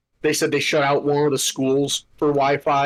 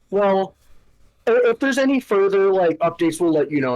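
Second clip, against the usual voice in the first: higher, lilting fake-polite girl voice; imitating the office girl; 5 semitones higher than usual. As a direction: mimic a chirpy customer-service girl.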